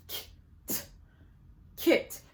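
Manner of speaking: k and t apart, then kit blended; sounding out. Shown as a demonstration. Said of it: "sound out the last sound".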